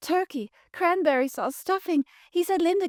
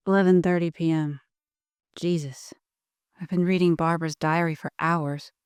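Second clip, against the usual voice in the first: lower, subdued voice of the diary's reader; lower, shaken and grim; 8 semitones lower than usual. Acted. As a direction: drop out of the diary into your own unsettled voice. Frame narrator after the diary.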